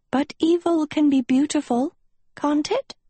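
high, wistful witch voice. A narrator's reading. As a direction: coo it like a lovesick witch. Sophie as a witch.

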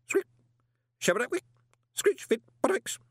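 high squeaky gibberish; garbled tube voice. Acted. Hugo answers through the speaking tube, squeaky and garbled. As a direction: squawk indistinct words through a speaking tube.

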